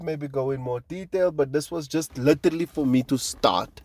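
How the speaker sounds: ordinary talk, nothing marked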